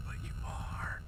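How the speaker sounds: teasing whisper. Real, not acted. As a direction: whisper the jab playfully. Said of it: "Why are we whispering?".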